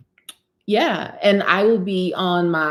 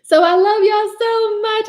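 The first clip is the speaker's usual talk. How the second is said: much higher and louder burst of love; gushing; 12 semitones higher, 6 dB louder than usual. Real, not acted. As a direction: let the affection burst out high. Emotional thanks to viewers.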